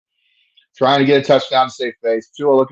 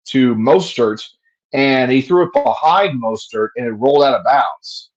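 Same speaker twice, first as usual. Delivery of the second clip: ordinary talk, nothing marked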